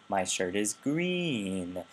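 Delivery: "green" drawn out long at the end; slow line, focus landing on "green"; focus stress on GREEN. Shown as a demonstration. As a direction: stretch "green" with focus intonation. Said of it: "focus intonation that's kind of going up and down".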